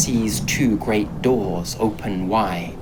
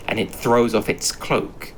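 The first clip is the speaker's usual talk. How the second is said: usual speaking voice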